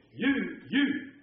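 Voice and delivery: slow, weighty repeat of "You, you"; emphatic stress. Shown as a demonstration. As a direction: lean hard on "You, you". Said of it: "That's really the way he said it".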